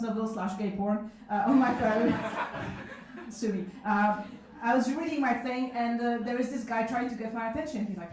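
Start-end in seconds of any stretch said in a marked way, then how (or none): none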